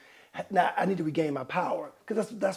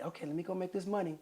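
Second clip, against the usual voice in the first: resigned; 10 dB quieter than usual. Retold or imitated. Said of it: "You like".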